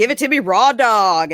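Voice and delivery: loud, high brash quote; brash mock line. Mocking the wife's stage bravado, loud and high. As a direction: belt it out like a bold comic. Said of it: "When she's like".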